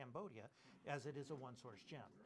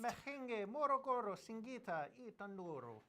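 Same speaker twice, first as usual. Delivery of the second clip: a hammed-up Irish accent, slow and louder, on Mahenge Morogoro Singita i Tanduro; 7 semitones higher, 8 dB louder than usual. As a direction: roll the place names out in a playful Irish accent. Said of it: "say Mehenge Spinel in an Irish accent".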